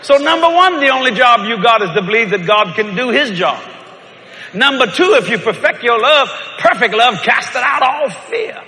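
loud high preaching, slowing at end. Acted. Preacher driving home the point loudly. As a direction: preach it to the congregation.

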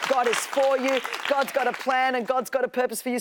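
ordinary talk, nothing marked

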